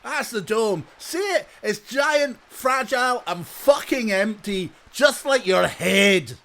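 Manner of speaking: loud, high Scottish game-show rant; Malcolm Tucker. Acted. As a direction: present it as an insult, shouted in Scottish. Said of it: "Malcolm Tucker hosting the Crystal Maze".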